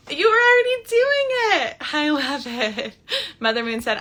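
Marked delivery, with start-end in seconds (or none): 0.1-3.0 delighted, much higher and louder; thrilled reaction to a fan's story; excited. Real, not acted. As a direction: burst out with joy. Delighted reply to a commenter.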